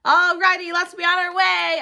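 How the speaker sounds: high, loud, slow shout; a child calling loudly through the house. Acted. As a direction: shout it toward the other room. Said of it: "I shouted".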